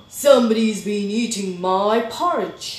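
loud, booming complaint; big, forceful bear outrage; indignant Daddy Bear. A narrator's reading. Daddy Bear discovering his eaten porridge, loudly indignant.

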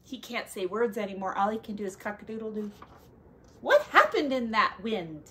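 ordinary delivery, nothing marked

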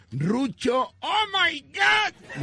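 agudo y lento, chillando de asombro; maravíllate en falsete; asombro exagerado. Retold or imitated. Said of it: "le dije". (el amigo se asombra de Rucho, chillón y lento)

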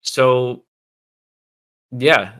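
strained, fading voice; a voice going hoarse; losing voice. Real, not acted. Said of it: "sorry, I'm losing my voice here".

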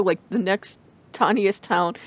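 ordinary delivery

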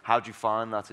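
ordinary talk, nothing marked

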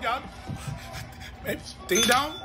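ordinary talk, nothing marked

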